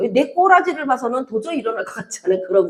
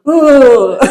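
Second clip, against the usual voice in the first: mock child whimper; 9 dB louder than usual.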